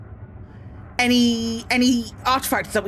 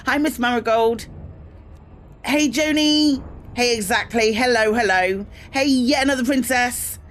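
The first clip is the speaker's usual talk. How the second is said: usual speaking voice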